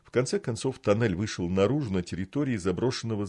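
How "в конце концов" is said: ordinary delivery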